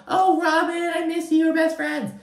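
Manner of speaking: very high, put-on gushing voice; mock fake friend. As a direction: squeal it in mock best-friend sweetness. Mimics two-faced fake friendliness.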